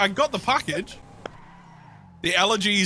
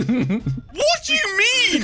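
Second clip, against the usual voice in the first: laughing disbelief; 12 semitones higher, much slower than usual. Real, not acted. Mock disbelief during banter.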